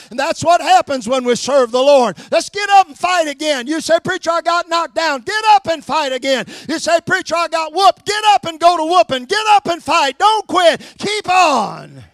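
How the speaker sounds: loud, rising call to get up and fight; preaching at full force. Acted. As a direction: climactic shouted exhortation. Preacher rallying the congregation.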